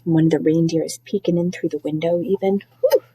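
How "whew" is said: ordinary delivery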